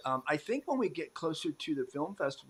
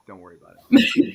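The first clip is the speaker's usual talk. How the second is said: loud joking reassurance; 7 semitones lower, 17 dB louder than usual. Real, not acted.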